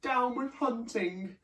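high, slow dinosaur hoot; high, hooted chant. A narrator's reading. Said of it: "For he hooted".

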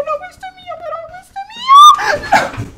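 high, squeaky mine with a laugh; squeaky possessive. Pretend. Gamer guarding her food in a high squeaky cartoon voice.

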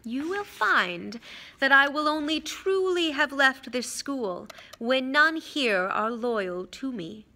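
deliberate, clearly articulated promise; measured, dignified declaration; Dumbledore, slow and clear. Acted. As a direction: weigh every word so none is missed. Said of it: "speaking very slowly and clearly".

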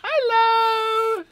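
put-on high little girl voice; squeaky child voice, high pitch; Dina. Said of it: "You turn me into Dina?".

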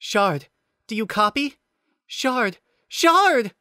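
rising panicked shouts of Shard; calling out. Acted. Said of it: "Nicole called".

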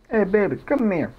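put-on high, cooing baby talk; baby talk to himself. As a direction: coo at yourself like a lover.

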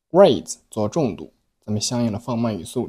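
ordinary talk, nothing marked

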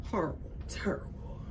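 soft whisper of dismay; whispered aside. Real, not acted. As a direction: breathe it out as a hushed aside.

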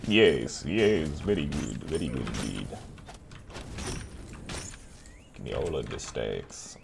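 low, slow sinister purr; lightly voiced low evil gloat; gloating villain. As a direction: savour it like a scheming villain. Gloating villain voice while stacking.

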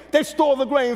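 usual speaking voice

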